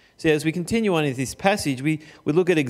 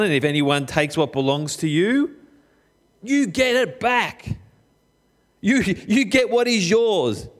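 mock wrong reading; 4 semitones higher than usual. Mocking wrong reading, emphatic.

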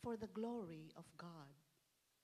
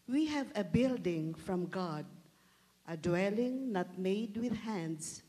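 louder, ringing scripture proclamation; 9 dB louder than usual. Acted. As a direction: proclaim it loudly to the church. Lector's reading swells in volume.

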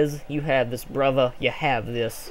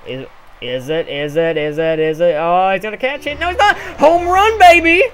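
building excitement; 2 semitones higher, 7 dB louder than usual. Real, not acted. Player watching his hit clear the fielder, mounting excitement.

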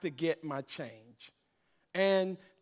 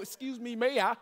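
lightly voiced prim, polite clerk; a hint of prissy politeness; mock proper talk; 5 semitones higher than usual. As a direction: play the overly proper gent, a touch mocking. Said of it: "Man, stop talking proper".

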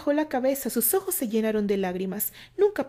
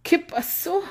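higher, faint pained question; weak and pained; 8 semitones higher, 4 dB louder, slower than usual. A narrator's reading. Candy asks what happened, hurting. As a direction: ask through the pain. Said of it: "haciendo un gesto de dolor".

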